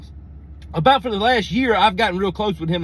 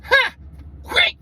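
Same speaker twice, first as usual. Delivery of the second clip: put-on sudden high squeal of a cheeky kid; high cackling kid voice; kid's gleeful taunt. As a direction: blurt it out like a mischievous toddler. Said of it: "and goes".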